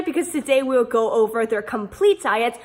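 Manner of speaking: ordinary delivery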